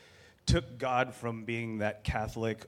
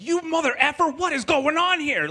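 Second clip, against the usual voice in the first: screamed, much higher and louder, furious; cursing God out; 11 dB louder than usual. Retold or imitated. Man screaming curses at God in a parking lot. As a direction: rage at the sky, full throat. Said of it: "I cursed God out".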